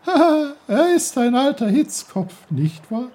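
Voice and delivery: high, loud, giggly teasing; giggling brook. Acted. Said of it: "kicherte der Bach".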